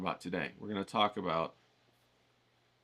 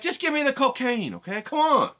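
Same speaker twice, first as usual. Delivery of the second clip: much higher and louder, mock impatience; exaggerated frustrated buyer; impatient demand; 12 semitones higher, 5 dB louder than usual. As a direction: beg for it, hammed-up exasperation. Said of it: "and you're like".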